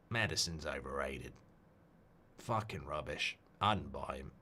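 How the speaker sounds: low, quiet Australian manager voice; Ange impression. Streamer doing a football manager for the chat, low Australian impression. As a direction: drop it down and mumble like an Aussie coach. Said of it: "I can do a bit of Ange".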